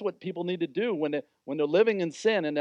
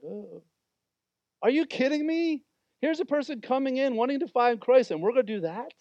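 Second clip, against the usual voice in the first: much higher, scornful disbelief; snooty churchgoer; 7 semitones higher than usual. Acted. Judgmental church people scoffing at a tattooed visitor, high and sneering. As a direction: sneer at the newcomer in a high put-on voice.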